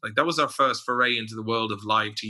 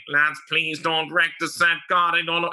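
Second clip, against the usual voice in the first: worried host impression; 4 semitones higher, 3 dB louder than usual. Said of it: "He was like".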